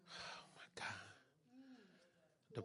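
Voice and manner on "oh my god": a soft, awed aside under the breath; awed whisper. Real, not acted. Awed aside during worship.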